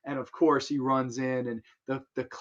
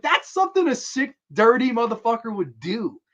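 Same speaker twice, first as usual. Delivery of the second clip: high and loud, much higher than usual; kid's shocked outburst; 9 semitones higher, 6 dB louder than usual. Retold or imitated. Reviewer quoting his childhood self, high, loud outburst. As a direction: blurt it out like a wide-eyed kid. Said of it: "I was like".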